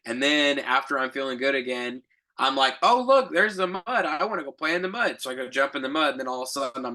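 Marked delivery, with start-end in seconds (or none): 2.8-5.2 put-on much higher and louder, a giddy little kid spotting mud; excited child. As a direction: play an eager child who can't resist the mud. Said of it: "I'm so much like a child".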